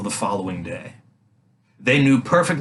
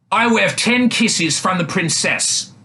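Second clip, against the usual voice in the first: cried out; 7 semitones higher than usual. A narrator's reading. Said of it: "he cried".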